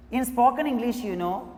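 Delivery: normal talking voice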